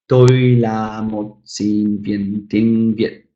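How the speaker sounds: slow, drawn-out Vietnamese, word by word; measured language-teacher Vietnamese, slowly; slow Vietnamese demo. Shown as a demonstration. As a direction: give each Vietnamese syllable its own space. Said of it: "I've just said I'm a student of".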